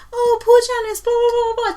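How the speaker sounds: high, mocking sing-song pity; a hint of sarcastic whine. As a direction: parody the crowd's fake sympathy. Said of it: "everyone going".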